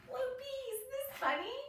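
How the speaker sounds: high playful question to the puppet; playful aside. Real, not acted. Teacher to puppet Loopy, high delighted question.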